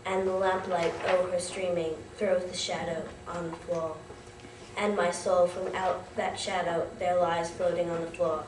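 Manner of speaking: low, subdued, measured verse; hushed poem recitation. Acted. Student closing The Raven for an audience, low solemn recitation.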